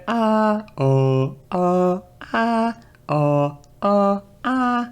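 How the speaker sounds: hop between sustained notes on uh and oh; adjusting pitch. Shown as a demonstration. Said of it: "we all know how to adjust pitch".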